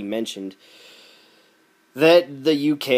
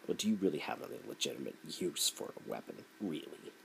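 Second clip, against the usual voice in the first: smug mock question; 8 semitones lower, 16 dB quieter than usual. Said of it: "he will say".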